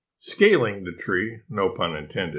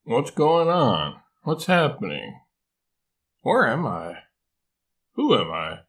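lightly acted waking confusion; just-woken koala, puzzled; mildly dazed questions, a little higher at first; groggy mumble; 4 semitones higher than usual. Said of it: "he mumbled".

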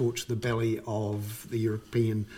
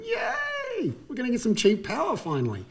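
mock cheer; 12 semitones higher than usual. Consumers cheering cheap power.

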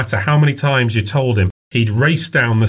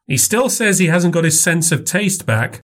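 put-on older woman voice; 8 semitones higher than usual. A narrator's reading. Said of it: "mother talking".

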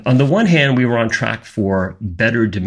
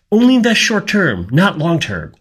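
creepy whisper voice; 7 semitones higher than usual. Retold or imitated. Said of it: "using that little creepy whisper voice".

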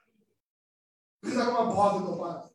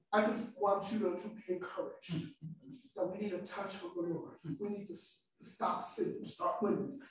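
much softer, intimate encouragement; soft closing words; 3 semitones lower, 7 dB quieter than usual. Acted. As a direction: draw them in gently at the close.

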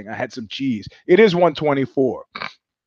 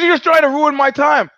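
imitating a loud, high-pitched protest; retold outburst of grievance; mock indignant; 9 semitones higher, 5 dB louder than usual. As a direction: push it high and loud as an accusing retort. Surfer's retort in retold quarrel.

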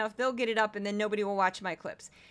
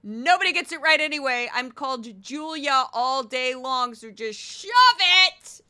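exasperated retort; 3 semitones higher, 8 dB louder than usual. Acted. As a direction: snap back at the teacher, ending in a yell. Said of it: "I just turn to them and say".

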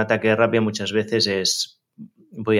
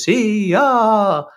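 put-on much higher and louder, a child's tearful sigh; high child's wail of agreement; small child voice; 12 semitones higher, 5 dB louder than usual. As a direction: squeak it out like a little girl giving in to sadness. Mother voicing daughter turning sad.

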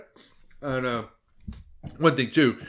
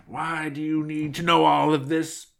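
high, put-on suspicious demand; glaring suspicion; 6 semitones higher than usual. A narrator's reading. Girl boss glares at the nosy wolf, suspicious. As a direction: challenge him in a raised character voice. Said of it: "glared at the wolf".

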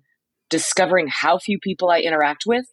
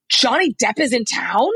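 higher, rising disbelieving question; imitated starstruck surprise; 5 semitones higher than usual. Retelling hotel clerk hearing about Johnny Depp, mock astonishment. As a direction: play a fan stunned by the news.

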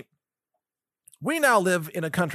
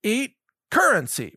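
mocking handout; 6 semitones higher than usual. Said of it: "hand it out to people like".

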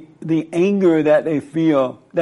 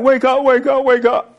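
high, loud frantic urging; frantic wake-up call; 9 semitones higher, 3 dB louder than usual. Retold or imitated. Disciples waking Christ in the storm, high frantic cries. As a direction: shake him awake in a panic. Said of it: "They're like".

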